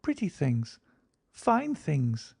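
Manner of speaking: put-on a high, eager little girl's cry; enthusiastic child. A narrator's reading. Girl shows her companion shiny mica, eager. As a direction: show off a treasure with delight. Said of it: "cried the little girl enthusiastically".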